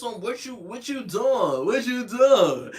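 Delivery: slow, drawn-out smug needling; smug taunting. Retold or imitated. Boyfriend taunting her from the house, slow and smug. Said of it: "this old smug grin on his face".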